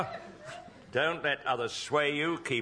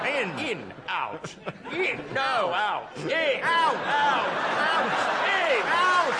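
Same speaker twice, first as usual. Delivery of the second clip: louder, higher rowing chant; rowing furiously; 9 semitones higher, 5 dB louder, slower than usual. Acted. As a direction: bark the strokes faster. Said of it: "rowing furiously".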